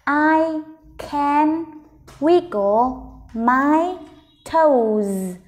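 "I... can... wiggle... my... toes", very slow with pauses; slow, word by word. Shown as a demonstration. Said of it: "Mình đọc chậm từng từ một nào". (đọc chậm từng từ "I can wiggle my toes")

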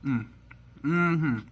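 humming while chewing; closed-mouth mumbling; mouth full. Real, not acted. Seen 2: Just bit burger; lips closed, cheeks full, chewing while humming.

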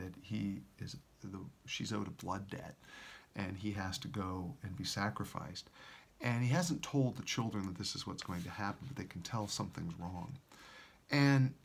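normal talking voice

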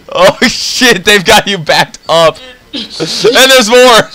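loud excited taunting; gleeful shout. Real, not acted. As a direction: shout with glee.

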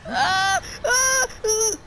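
high, loud "ah" cries; loud yelps. Real, not acted. Player crying out at the game, loud high yelps. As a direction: cry out in short high bursts.

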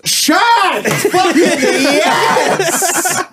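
mock ecstatic yell, very high and drawn out; formality dropped. Imitating the accountant hearing of the podcast, ecstatic yell. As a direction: stretch it into an over-the-top celebration. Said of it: "immediately drops all the formal shit".

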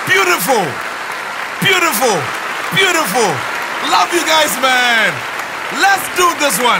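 high, sing-out praise in short bursts; high-pitched hype. Real, not acted. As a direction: call out happily to your fans, pitched high. Hyping up audience before segment.

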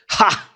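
sharp, high scornful "Ha!"; mocking scoff. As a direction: spit out a contemptuous laugh. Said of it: "like a put down".

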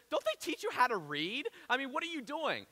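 high-pitched mock cop questioning; acted cop. Cop to butchering suspect, lightly acted exasperation. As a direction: play an exasperated cop, voice lifted. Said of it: "the cop looks at this, this guy and he goes".

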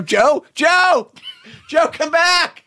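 jokey high yell to call someone back; mock calling out. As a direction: shout after him as he walks away. Calling after Joe Biden jokingly.

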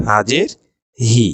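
ordinary talk, nothing marked

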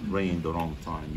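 ordinary talk, nothing marked

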